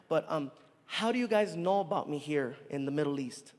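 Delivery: ordinary delivery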